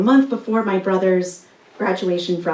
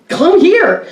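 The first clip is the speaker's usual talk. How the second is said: calling out; 8 semitones higher, 6 dB louder than usual. Retold or imitated. Guide calls her over underwater.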